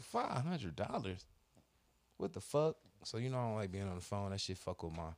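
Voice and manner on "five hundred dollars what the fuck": higher, slow, stunned echo of "five hundred dollars"; retold disbelief. Repeating the insurer's charge back, stunned and indignant. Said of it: "I'm like".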